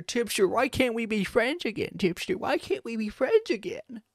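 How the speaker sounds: high, pleading whine; whiny plea. Retold or imitated. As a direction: beg like a wounded friend. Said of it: "now is like".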